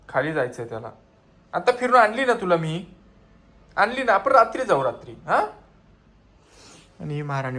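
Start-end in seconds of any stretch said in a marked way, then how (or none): 1.5-5.5 high coaxing pet voice. Real, not acted. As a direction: coax the dog sweetly. Coaxing dog sweetly. (लाडिक आवाजात कुत्र्याला समजावत)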